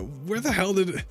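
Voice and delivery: real frustration rising; fired up. Real, not acted. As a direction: let the indignation break through. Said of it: "I'm fired up".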